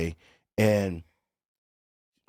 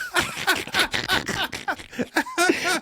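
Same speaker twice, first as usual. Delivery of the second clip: real laughter breaking out mid-story; big laugh. Real, not acted. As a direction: crack up at yourself. Laughing at his own cover story.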